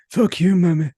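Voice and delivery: Irish accent sneaking into a royal impression; failed Charles impression. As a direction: try for the King, land in an Irish accent. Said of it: "that was more Irish than".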